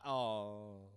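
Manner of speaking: ordinary talk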